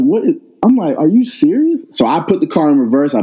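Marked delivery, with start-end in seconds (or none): 0.0-1.8 climbing much higher with each phrase; rising disbelief, almost squeaking; incredulous. Retold or imitated. Sees her photographing his car.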